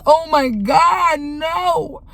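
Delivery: drawn-out, exclaimed mock dismay; long wail of disbelief, retold; retold shock. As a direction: stretch out a horrified reaction as you retell it. Retold shocked reaction to daughter's words.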